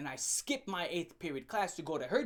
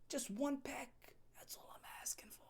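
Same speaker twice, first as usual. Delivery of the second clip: softer, fading into a whisper; creepy whisper; 5 semitones higher, 16 dB quieter than usual. Retold or imitated. Asking his girlfriend for one peck, whispered. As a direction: coax her in a creepy hush.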